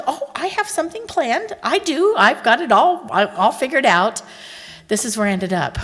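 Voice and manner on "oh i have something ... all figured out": high, smug-sounding husband voice; mock proud husband. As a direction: play him pleased with his secret plan. Husband boasting about anniversary plan.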